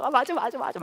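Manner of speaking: squealing high with joy; imitating a thrilled girlfriend; delighted. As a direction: girlfriend hearing he is coming, thrilled. Wife's delighted reply continues.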